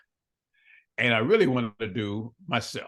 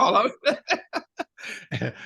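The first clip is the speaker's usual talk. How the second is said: laughing; 6 semitones higher, much slower than usual. Real, not acted. Laughing admission of ambition.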